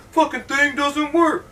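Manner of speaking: high, slow grumble of frustration; fed up with the broken radio; frustrated Jerry. Retold or imitated. Jerry grumbling at the broken radio, frustrated. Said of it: "he's like".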